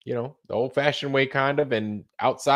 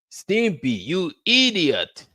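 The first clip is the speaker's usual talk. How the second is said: high, slow cartoon scolding; 8 semitones higher, slower than usual. Mocking the staff in a high, slow cartoon scold. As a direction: scold like a cartoon character, drawn out. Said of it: "I was like".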